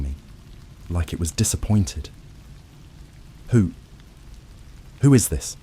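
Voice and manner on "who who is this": frightened, halting stammer. A narrator's reading. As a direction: stammer it out in fear. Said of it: "I stammered".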